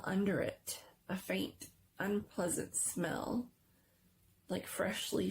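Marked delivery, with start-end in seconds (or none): none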